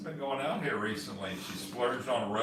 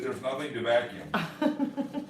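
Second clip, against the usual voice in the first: slow, laughing through the quote; retelling the friend's line with a laugh; much slower than usual. Retold or imitated. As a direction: chuckle out the friend's words. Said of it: "He said".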